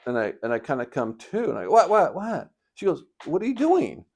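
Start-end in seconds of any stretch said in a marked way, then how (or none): 1.7-2.5 high, loud repeated questioning; mock startled awake. 3.2-4.0 high, louder incredulous question; imitating puzzled wife.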